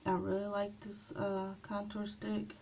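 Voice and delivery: ordinary talk, nothing marked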